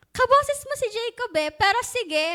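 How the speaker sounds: doubtful father. Retold or imitated. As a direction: accept it grudgingly. Father accepts despite doubt. (nagdududang ama)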